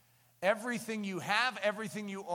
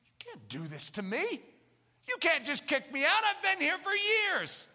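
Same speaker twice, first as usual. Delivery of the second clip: rising high, pleading; whiny protest; 9 semitones higher, 4 dB louder than usual. Pretend. Old habit pleading with the owner, whiny. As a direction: beg not to be kicked out.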